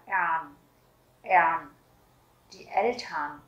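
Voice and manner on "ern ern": "Ern" as one syllable, the R melted into the vowel; pronunciation of "Ern". Shown as a demonstration. Teacher shows beginners a tricky German ending, careful pronunciation of "Ern". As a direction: model "Ern" slowly for learners, no rolled R. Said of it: "các bạn sẽ đọc là".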